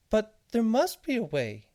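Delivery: very high, slow, fretting squeak; a tiny voice pleading; flustered seed. Acted. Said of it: "said the flustered seed".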